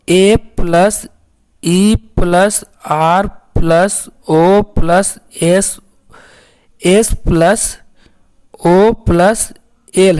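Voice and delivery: letters spelled slowly one by one; slow spelling out. Shown as a demonstration. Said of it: "aerosol किस तरह से हम लोग पढ़ रहे हैं". (धीरे-धीरे अक्षर बोलना)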